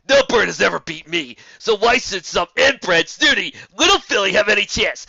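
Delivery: loud, contemptuous griffin; loud scornful rant; angry insult. A narrator's reading. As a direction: spit out the contempt.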